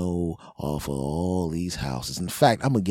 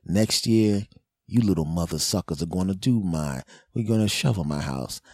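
ordinary talk

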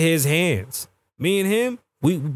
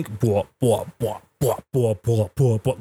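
low, rhythmic boop sounds; imitated machine booping; 5 semitones lower, slower than usual. Patient mimicking the echogram on his chest, rhythmic booping. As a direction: mimic a scanner with evenly spaced boops. Imitates the scanner checking him.

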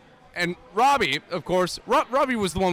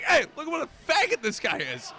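retold heel taunt; 7 semitones higher than usual. Wrestler shouting to kids.

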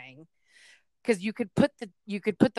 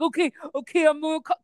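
high, louder, excited agreement; eager dad; 8 semitones higher, 5 dB louder than usual. Retold or imitated. Dad agreeing to prank his other daughter, high and eager. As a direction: jump on the plan excitedly. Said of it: "He's like".

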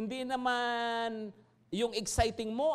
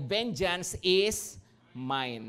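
slow scripture quote; 3 semitones lower, much slower than usual. Acted. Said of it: "Sabi po niya ay".

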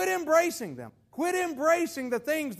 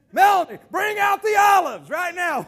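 loud, higher playful order; mock command; 5 semitones higher, 8 dB louder than usual. Preacher teasingly calls to Melanie for olives, loud mock order. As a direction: pretend to call for the olives.

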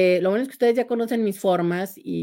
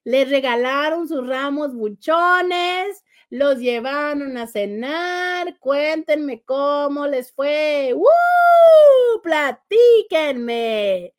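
drawn-out, sing-song mock romantic enthusiasm; sarcastic gushing; 5 semitones higher, much slower than usual. Host asks viewers about their Valentine's Day, sarcastically gushing. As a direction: gush slowly with over-the-top Valentine cheer. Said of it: "Ese sarcasmo cala".

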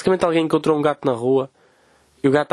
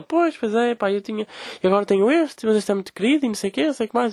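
imitating older lady; 7 semitones higher than usual. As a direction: drag it out like the neighbour. Voices older woman rambling.

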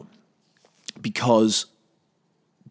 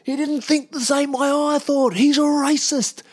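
mocking, much higher shrieking accuser pointing a finger; shrill accuser; much slower than usual. Ranter mocks a self-righteous accuser with a shrill, high shriek. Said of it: "pointing a big dirty finger at someone in public and going".

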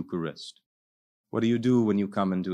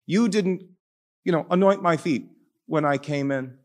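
much higher, earnest rebuke to the host; pleading Jesus; 8 semitones higher than usual. Retold or imitated. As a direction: raise the voice high in gentle reproach. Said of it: "He says".

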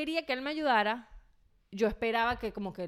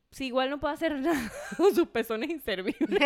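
laughing snark; 3 semitones higher, 4 dB louder than usual. Retold or imitated.

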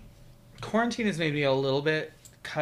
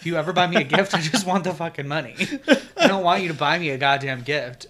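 louder rant; 2 semitones higher, 7 dB louder, faster than usual. Real, not acted. Ranting about gifts, louder.

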